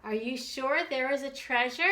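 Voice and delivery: ordinary delivery, nothing marked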